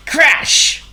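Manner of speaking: high, loud burst on the word; exclaimed crash. A narrator's reading. Narrator as the burglar hits the trip string, explosive exclamation. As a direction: blurt it like a sudden smash.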